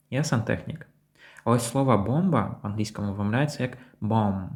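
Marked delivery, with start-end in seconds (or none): 4.0-4.5 "bomb" ending on M, no final B; silent B. Shown as a demonstration. Teacher shows English word for бомба, silent B. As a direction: say "bomb" as bom. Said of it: "без бі в кінці".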